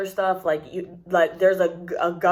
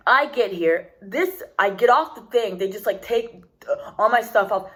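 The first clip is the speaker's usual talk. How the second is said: normal talking voice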